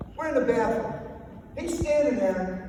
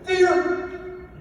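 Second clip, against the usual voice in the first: sudden exclamation; 9 semitones higher than usual. Real, not acted. Speaker's punchline reveal.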